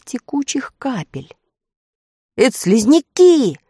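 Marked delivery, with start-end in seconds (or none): 2.4-3.6 excited cry, higher and louder; boy's exclamation. A narrator's reading. Boy spotting snails on the wall, excited exclamation. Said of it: "воскликнул мальчик".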